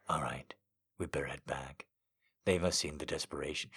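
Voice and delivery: low, soft, muttered man; low male voice. Acted. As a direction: keep it low and hushed. Seductive male voice.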